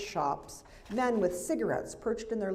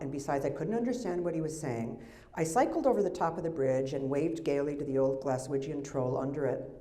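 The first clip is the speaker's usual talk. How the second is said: normal talking voice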